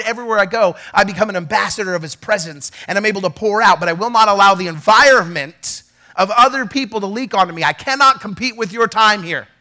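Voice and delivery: normal talking voice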